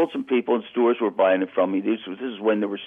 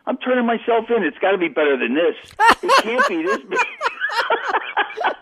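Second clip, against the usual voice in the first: laughing in despair; 13 semitones higher, slower than usual. Retold or imitated. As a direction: pitch climbing, dissolving into laughter. Exasperated retold plea, laughing.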